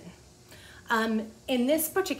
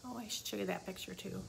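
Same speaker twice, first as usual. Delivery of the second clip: softer and quicker, half to herself; soft quick aside; 11 dB quieter, faster than usual. Real, not acted. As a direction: mutter it as you remember the picture.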